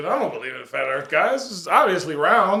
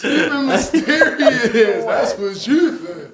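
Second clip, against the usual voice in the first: high, slow laughing repeat; 8 semitones higher, 6 dB louder, much slower than usual. Real, not acted. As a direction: laugh while echoing. Mocking laughter.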